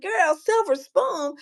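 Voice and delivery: slow, high retort of amused disbelief; mock disbelief. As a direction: stretch it out in playful disbelief. Acting out disbelief at friend's assumption.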